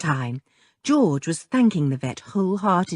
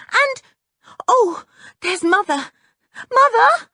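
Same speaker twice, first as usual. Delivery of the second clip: relieved call; 6 dB louder than usual. Acted. Girl spotting mother, calling out.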